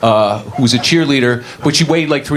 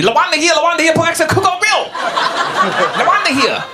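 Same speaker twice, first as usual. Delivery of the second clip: very high-pitched frantic calling; hammed-up panic as a regular arrives; staff going crazy. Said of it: "the whole staff goes crazy".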